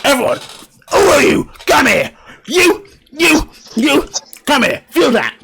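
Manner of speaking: high-pitched mock chef summons; hammed-up, high calling-out; Gordon Ramsay. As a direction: bark out orders like an irate chef. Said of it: "walks onto the side and goes".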